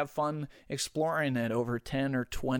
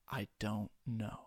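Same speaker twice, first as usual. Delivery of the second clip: fading movie line; 3 semitones lower, 10 dB quieter than usual. Acted. Quoting the boxing film to listeners, hushed and trailing off. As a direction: drop to a hushed, drawn-out murmur. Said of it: "Rocky Four gets me every time".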